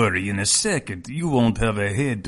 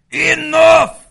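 high, loud roar of command; commanding shout; 9 dB louder than usual. Acted. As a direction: bellow to stop the fight. Thug commands a halt.

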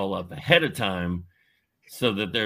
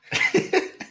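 high breathy laugh; 4 dB louder than usual. Real, not acted. Laughs at own story.

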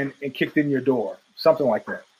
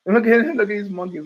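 ordinary talk, nothing marked

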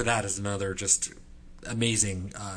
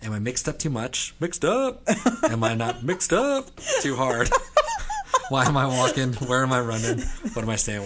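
loud, higher reading broken by laughter; laughing recital; 4 semitones higher, 6 dB louder than usual. Real, not acted. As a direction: recite the lines while cracking up. Reciting favourite lines while laughing.

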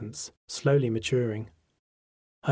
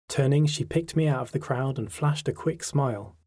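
plain reading voice

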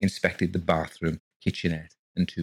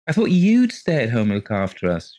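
dolefully; 5 semitones higher, 7 dB louder than usual. Acted. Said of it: "she said dolefully".

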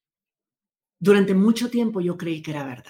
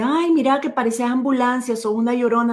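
higher, teasing sing-song; mocking a crier; 5 semitones higher than usual. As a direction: poke fun at a crying child. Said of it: "se burló de mí, de que estaba llorando".

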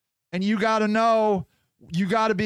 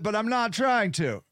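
ordinary talk

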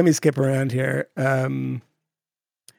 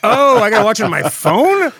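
louder and higher, laughing in disbelief; incredulous outburst; 4 semitones higher, 4 dB louder than usual. Retold or imitated. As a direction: rant at the idea of watching on a phone. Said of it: "I was like".

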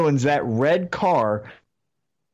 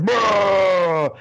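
crowd yell; 3 semitones higher than usual. Retold or imitated. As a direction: call it out like a frustrated fan in the crowd. Fans yelling at wrestler's push.

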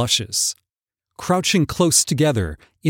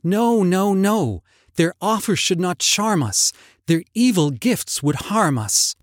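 frightened refusal; 7 semitones higher than usual. Acted. Lizzie refuses goblin fruit.

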